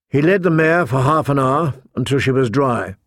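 usual speaking voice

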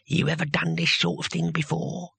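a lightly voiced squelchy old man; damp, sly question at the ear. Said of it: "the squelchy voice behind him said".

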